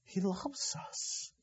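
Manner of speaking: soft, very slow and tender; tender hush. Real, not acted. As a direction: let the words melt gently. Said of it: "in a very tender way".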